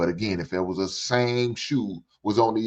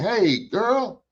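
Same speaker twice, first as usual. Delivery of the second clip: much higher, scolding sister's voice; high, chiding sister stepping in; sister scolding a girl; 10 semitones higher than usual. Pretend. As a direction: play a sister calling a girl out for hitting a man. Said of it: "I don't see none of you sisters saying".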